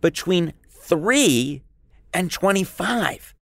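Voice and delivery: spelling each number out slowly; drawn out for emphasis, deliberate; slow and emphatic. Shown as a demonstration. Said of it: "So, you got that?".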